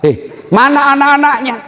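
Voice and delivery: high, sharp retold demand; indignant question. Preacher asks widow where her children are, indignant. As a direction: pitch it up as an indignant query.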